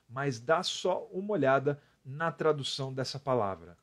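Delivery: usual speaking voice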